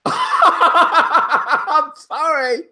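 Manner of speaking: real laughter breaking up the words; laughing apology. Real, not acted. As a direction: say sorry while cracking up.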